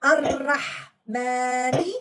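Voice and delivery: slow teaching recitation of Ar-Rahmanir Rahim; slow recitation demo. Shown as a demonstration. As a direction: stretch each syllable for the student. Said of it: "Did you see how we say it".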